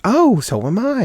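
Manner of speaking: high, swooping mock surprise; retold happy coincidence, voice leaping high; pleasantly surprised. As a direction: imitate his delighted discovery, pitched right up. Boyfriend's surprised reply, acted out.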